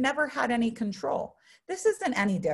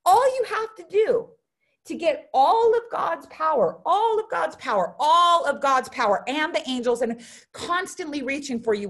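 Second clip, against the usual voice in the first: excited preaching; 5 semitones higher, 4 dB louder than usual. Real, not acted. Preaching climax about God's power.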